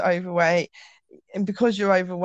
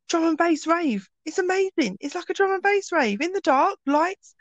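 much higher, gushing about the rave class; gushing friend; 10 semitones higher than usual. Retold or imitated. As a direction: sell it like the best night out ever.

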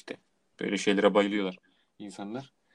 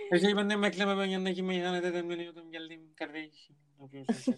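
put-on tipsy slurring drunk, higher voice; Metin Akpınar drunk; 6 semitones higher than usual. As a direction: stagger in talking like a drunk. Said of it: "Metin Akpınar'ın Deve Kuşu Kabare sarhoş taklididir".

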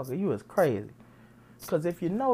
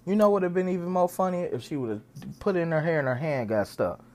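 normal talking voice

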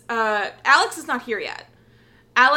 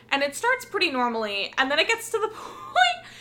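normal talking voice

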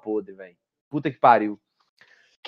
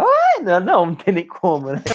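man doing a woman's voice; 3 semitones higher than usual. Pretend. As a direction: squeak it out like a woman. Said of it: "Vou, vou fazer voz de mulher?".

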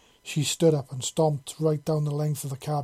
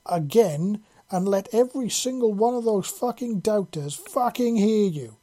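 loud, higher-pitched barked order; drill sergeant; 7 semitones higher, 5 dB louder than usual. A narrator's reading. Shepard driving Parser to silence the doubters, barking orders. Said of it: "starting to sound like a fucking drill sergeant".

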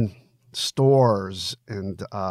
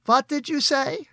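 higher wife's voice calling across the room; Gertie calling out. Acted. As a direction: call over from the back, curious.

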